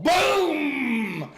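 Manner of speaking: loud, high shouted boom; big shouted crash sound; imitated crash. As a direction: belt out the impact noise. Man slams into the wall.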